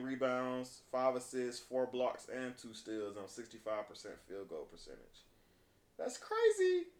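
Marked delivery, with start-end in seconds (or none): 6.0-6.9 high, amazed exclamation. Real, not acted. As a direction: sound blown away. Amazed at stat line.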